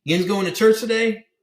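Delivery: high, loud Pittsburgh local; Pittsburgh accent. Retold or imitated. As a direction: put on a Pittsburgh twang for the yins line. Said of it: "so they call 'em yins".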